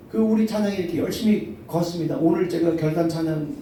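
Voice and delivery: ordinary talk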